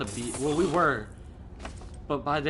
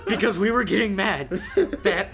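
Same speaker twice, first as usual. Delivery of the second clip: louder, higher, outraged protest; real indignant rant; 6 semitones higher, 6 dB louder than usual. Real, not acted. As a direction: rant loudly at the unfairness. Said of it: "He's shaking with anger right now".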